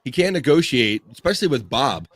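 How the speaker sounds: normal talking voice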